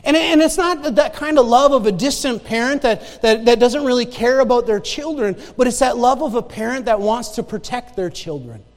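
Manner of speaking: loud, building sermon; preaching at full force. Acted. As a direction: drive it home from the pulpit.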